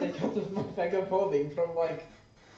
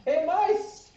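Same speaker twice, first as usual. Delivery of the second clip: yelling in mouse; 8 semitones higher than usual. Acted. Cat calling the mice over with cheese, in a high mouse yell. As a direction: shout up high like a mouse luring its friends. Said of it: "I just wanna yell in mouse".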